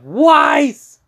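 high, loud cry of her name; desperate high-pitched call; acted scream. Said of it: "John screams out".

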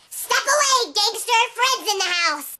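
high-pitched mock tough warning; childish tough-guy boast; tough-kid bravado. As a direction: puff up and warn off the bullies. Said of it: "these gangster kids came up to me and tried to threaten".

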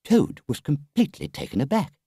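plain reading voice

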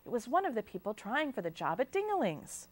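usual speaking voice

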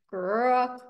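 acted ground-shaking toad noise; loud croak. Loud terrible noise in story.

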